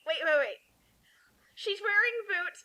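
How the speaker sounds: normal talking voice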